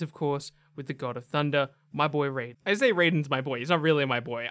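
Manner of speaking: ordinary talk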